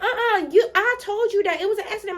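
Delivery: high, fast protest; protesting kid. Retold or imitated. Kid protesting to the teacher, high fast objection. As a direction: blurt the objection. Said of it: "I'm like".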